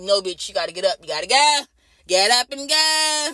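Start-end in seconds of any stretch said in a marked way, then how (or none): 2.1-3.3 slow drawn-out order. Retold or imitated. Nurse ordering patient out.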